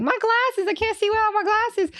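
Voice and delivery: high-pitched frantic cartoon plea; hammed-up high cartoon whine; Velma. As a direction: squeal in helpless panic. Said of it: "that Velma from Scooby-Doo?".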